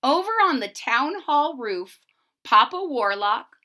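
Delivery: ordinary delivery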